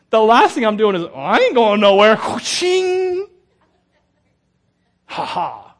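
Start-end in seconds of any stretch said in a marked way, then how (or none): 1.0-2.2 loud, hammed-up refusal to budge; mock defiance. Imagined self refusing to flee. 2.2-3.3 high, loud whooshing sound effect; sword whoosh. Retold or imitated.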